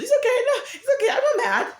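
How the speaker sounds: high, light mock reassurance with a laugh; falsetto brush-off. Pretending not to be mad, high and laughing. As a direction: brush it off in a squeaky fake-cheerful voice. Mock dismissing own feelings.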